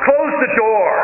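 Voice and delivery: sharp yell across the room. Retold or imitated. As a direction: shout it over the icy wind. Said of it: "somebody yelled".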